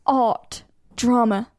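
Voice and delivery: ordinary talk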